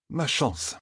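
soft murmur, pitch lifted; softly muttered appeal to luck. A narrator's reading. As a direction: murmur it to yourself. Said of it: "murmura Mat".

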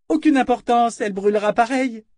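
high, loud, dismissive princess; imperious bully; cruel princess. Acted. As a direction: dismiss it loudly in a high girl's voice.

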